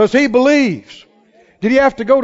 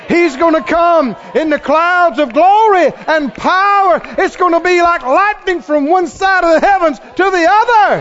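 loud, high, proclaiming shout; full-force revival preaching; fervent preaching; 6 semitones higher, 5 dB louder than usual. Acted. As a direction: thunder it to the congregation.

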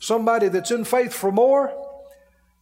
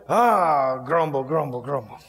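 mock grumbling; 6 semitones lower than usual. Grumbling at the alarm clock.

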